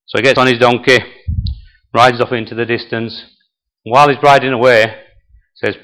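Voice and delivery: normal talking voice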